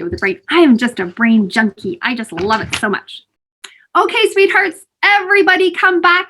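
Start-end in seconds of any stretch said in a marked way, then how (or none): 4.0-6.3 high, bright call to the group. Real, not acted. Calling children back to group.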